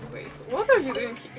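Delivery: ordinary talk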